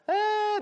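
high, held demonstration scream; mock scream. Shown as a demonstration. Preacher shows congregation how Israelites screamed, mock scream. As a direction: let out a put-on, high-pitched "ah". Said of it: "Y'all wanna scream like".

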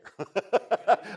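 short, hearty chuckle; real laugh. Real, not acted. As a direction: laugh freely at your own remark. Laughs after saying he doesn't care.

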